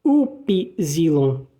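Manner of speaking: Upsilon slowly, each syllable; German Upsilon. Shown as a demonstration. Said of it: "O Y se pronuncia assim".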